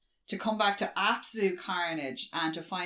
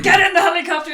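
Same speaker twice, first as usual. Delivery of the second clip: loud, high acted yell; urgent shouted order; 11 semitones higher, 12 dB louder, faster than usual. Jordan orders board members to escape, urgent shout. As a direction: shout them aboard in mock urgency.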